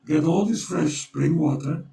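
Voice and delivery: ordinary talk, nothing marked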